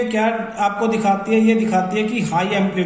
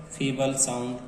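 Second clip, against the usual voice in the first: much softer, lower and quieter; feeble sound demo; 7 semitones lower, 9 dB quieter than usual. Shown as a demonstration. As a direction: show a feeble sound by saying it faintly. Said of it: "low amplitude feeble sound". (धीमी, कमज़ोर आवाज़ में)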